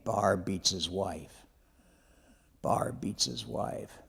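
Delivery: soft, slow whispered jeer; whispered taunt. Retold or imitated. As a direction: hiss the taunt under your breath, slowly. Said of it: "started saying the same thing".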